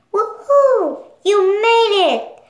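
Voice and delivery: high, loud happy whoop; excited cheer. Acted. Bing Bong cheers for Joy.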